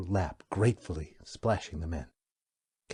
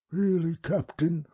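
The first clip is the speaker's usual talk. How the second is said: slow, high, unhurried alien creature voice; wub's voice; 10 semitones higher, 6 dB louder than usual. Acted. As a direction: speak as a placid, courteous old beast. The wub speaks.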